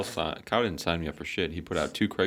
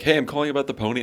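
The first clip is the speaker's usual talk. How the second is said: higher, louder, fast eager call; eager caller; 8 semitones higher, 6 dB louder, faster than usual. Retold or imitated. Stranger calling about the mini pony, mock eager. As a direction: rush it like a keen buyer. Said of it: "someone's like".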